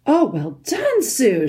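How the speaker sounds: high-pitched congratulatory cheer; cheering shout. A narrator's reading. Children cheer Susan's winning shot, excited shout. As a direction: call out praise, starting high and falling. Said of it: "shouted the other children".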